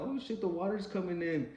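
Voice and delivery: retold scream. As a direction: cry out in alarm as the water comes in. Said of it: "she screams out".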